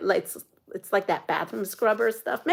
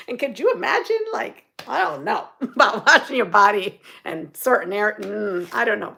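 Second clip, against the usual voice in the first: usual speaking voice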